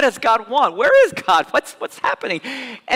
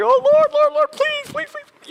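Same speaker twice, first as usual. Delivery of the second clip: much higher, desperate begging, fading at the end; frantic pleading prayer; 4 dB louder than usual. Pretend. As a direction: beg God anxiously the night before a test. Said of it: "you're like".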